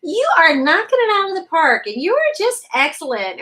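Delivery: shouted high hype; loud, high cheering praise; over-the-top cheerleading. Retold or imitated. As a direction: pump her up way too hard. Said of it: "asserting at them".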